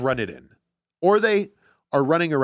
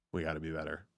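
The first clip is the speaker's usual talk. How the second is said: low, quiet, emotionless monotone; much lower and softer, deadpan coach answer; Bill Belichick impression; 7 semitones lower, 5 dB quieter, faster than usual. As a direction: drop the voice and drone like a bored coach. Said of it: "Bill Belichick answers with".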